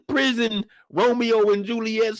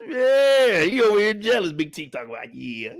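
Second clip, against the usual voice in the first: normal talking voice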